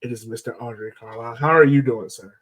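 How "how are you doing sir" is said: usual speaking voice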